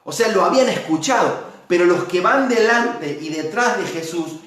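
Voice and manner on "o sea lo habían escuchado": usual speaking voice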